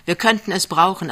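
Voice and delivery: ordinary delivery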